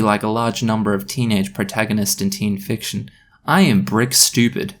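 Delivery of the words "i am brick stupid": usual speaking voice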